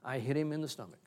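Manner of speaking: ordinary talk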